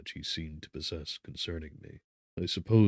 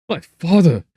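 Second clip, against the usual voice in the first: loud, high burst on father; joyful cry; 12 semitones higher, 17 dB louder than usual. A narrator's reading. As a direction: cry out in sudden relief. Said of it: "cried I".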